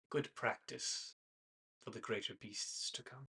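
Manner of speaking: menacing hunter. Acted. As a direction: quietly threaten the prey. GM voices hunter, quiet menace.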